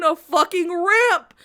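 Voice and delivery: slow, loud, breaking into laughter; laughing rant. Retold or imitated. Ranting to friends while laughing. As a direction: crack up mid-rant.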